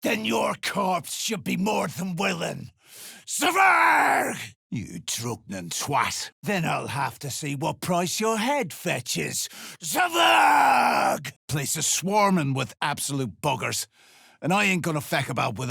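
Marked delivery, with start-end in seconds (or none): 3.3-4.4 a full-throated bellow of a name, high and loud; angry shout. Acted. Mercenary bellowing for Zvarg, furious shout. 9.8-11.3 a full-throated bellow of a name, high and loud; angry shout. Acted. Mercenary bellowing for Zvarg, furious shout.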